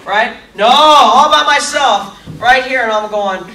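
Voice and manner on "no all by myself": loud, drawn-out NO, higher pitch; emphatic protest. Retold or imitated. Actor complains to audience of dancing alone, emphatic protest. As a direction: stretch "No" out loud and aggrieved.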